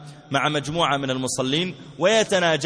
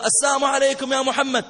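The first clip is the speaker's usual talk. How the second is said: high, raised call to someone indoors; pitched-up acted hail; called-out greeting; 10 semitones higher than usual. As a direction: imitate passers-by calling a greeting. Passers-by calling out mock greeting.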